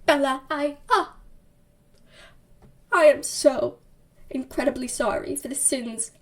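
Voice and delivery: mock-tearful, halting apology with long pauses; milk every pause like a tortured melodrama hero; hammed-up dramatic. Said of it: "he's all dramatic".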